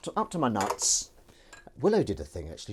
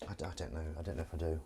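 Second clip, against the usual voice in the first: low, very soft muttering; hushed, hesitant reply; unsure mumble; 7 semitones lower, 11 dB quieter, much faster than usual. Real, not acted.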